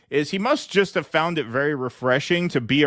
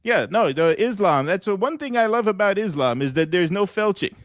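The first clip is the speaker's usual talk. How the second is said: higher, bragging Trump-style rambling; Donald Trump voice; 6 semitones higher than usual. Acted. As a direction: play Trump chatting up a guest, pleased with himself. Trump voice praising Islam.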